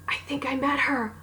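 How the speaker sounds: breathless whisper of realization; hushed, stunned whisper; awed whisper. Retold or imitated. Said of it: "I go".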